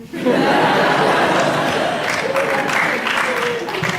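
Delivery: a real, airy burst of laughter; laughing at his own joke; breathy laugh. Real, not acted. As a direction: let the laugh slip out.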